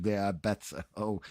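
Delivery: ordinary talk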